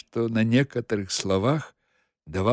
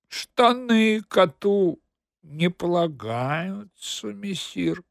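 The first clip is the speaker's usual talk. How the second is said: slow, high, dignified reply; 7 semitones higher, slower than usual. A narrator's reading. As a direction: answer with great dignity. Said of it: "с большим достоинством отвечал кот". (с большим достоинством)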